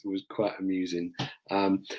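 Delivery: plain reading voice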